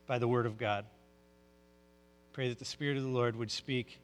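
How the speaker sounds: soft, low, hushed prayer; pastor's hushed pulpit prayer. Real, not acted. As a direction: pray quietly and earnestly to the Lord. Pastor prays before the sermon.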